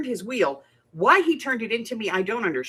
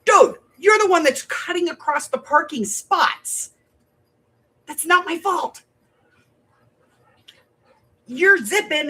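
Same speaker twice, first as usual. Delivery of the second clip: mock indignant rant, higher and slower; retold telling-off; 7 semitones higher, 5 dB louder, slower than usual. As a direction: replay the argument, half-heated. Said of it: "he's yelling at me".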